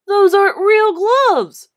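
loud, high, slow scoff; bully scoffing at the boy. Acted. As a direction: brush his claim aside. Bully argues.

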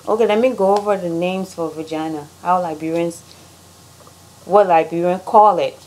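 ordinary talk, nothing marked

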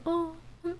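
soft, high letdown voice; disappointed Raidman. Pretend. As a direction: let the hope sink quietly. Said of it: "Raidman so".

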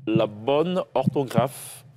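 slow quiz read, each word weighed; slow, deliberate. Shown as a demonstration. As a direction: stretch out occurrence for the player. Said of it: "Il me plairait de connaître". (lecture lente et appuyée)

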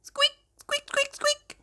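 tiny very high squeaks; mouse squeaks. Acted. Reader voicing tiny mice, soft high squeaks. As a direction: squeak softly like little mice.

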